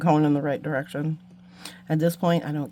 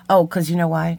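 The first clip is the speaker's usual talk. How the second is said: ordinary delivery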